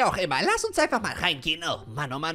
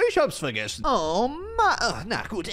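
usual speaking voice

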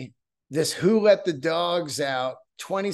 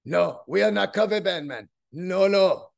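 hammed-up fake Caribbean accent; bad Caribbean accent. Band member insisting they are no cover band, in a bad Caribbean accent. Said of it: "I love doing bad accents".

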